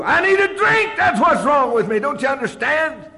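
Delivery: very high, loud begging cry; desperate shout. Retold or imitated. Pleading with Tom for a drink, desperate shout. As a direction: plead at the top of your voice. Tom threatens to throw him out.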